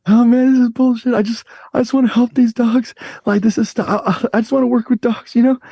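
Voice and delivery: put-on a kid on the edge of tears, begging to help; emotional. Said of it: "I'm just so emotional".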